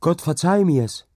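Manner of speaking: high, loud plea to God; crying out. A narrator's reading. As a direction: cry out for forgiveness. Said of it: "rief sie".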